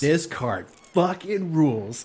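hyped, slow and emphatic; excited. Real, not acted. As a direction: savor every word. Echoing friend, hyping the card.

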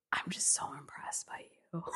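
whispered, breathy admiration; hushed compliment; soft whisper. Retold or imitated. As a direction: murmur it softly in awe. Date's quiet compliment.

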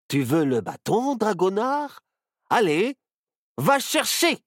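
high, loud playful coaxing; coaxing a dog. Acted. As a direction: tease the dog to fetch. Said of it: "Il dit à l'animal".

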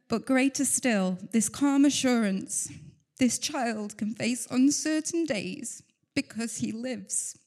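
slow, holding back tears as the words come out; a mother tearfully reciting a hymn verse, slowly; emotional slow recital. Real, not acted. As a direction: read the lyric slowly, fighting to stay composed. Reading hymn lyrics slowly, emotional.

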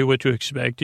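usual speaking voice

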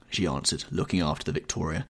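ordinary delivery

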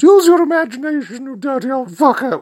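indignant falsetto snap; high falsetto client. Acted. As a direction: fire it back in a squeaky falsetto. Client snaps back in story.

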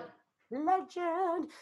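slow high "legend"; drawn-out hype. Real, not acted. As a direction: stretch it out like a hype host. Hyping the guest.

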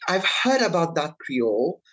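ordinary talk, nothing marked

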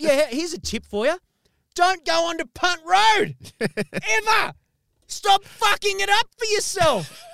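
loud, high furious telling-off; yelling at the driver who took forever; angry rant. Retold or imitated. Scolding the late delivery driver, shouting high and loud.

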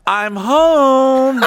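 high, drawn-out singsong call; imitated "honey, I'm home" style holler; mock homecoming call. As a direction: call it out high and long, mimicking an arrival. Imitating mom arriving, calling out.

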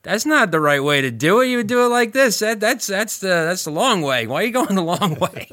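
a hint of a bossy instructor correcting; mock scolding teacher. Shop teacher scolding a student, mock bossy voice.

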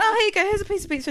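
very high, sing-song kind voice; sugary sweet offer. Retold or imitated. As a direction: offer it like a sweet big sister. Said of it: "I went".

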